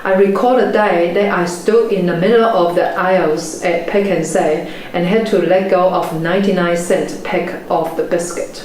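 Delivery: normal talking voice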